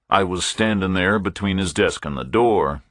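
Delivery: usual speaking voice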